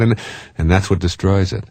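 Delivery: ordinary delivery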